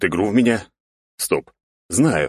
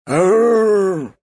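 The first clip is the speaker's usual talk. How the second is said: pained yelp; 14 semitones higher, 5 dB louder than usual. Acted. Neighbour hit by door.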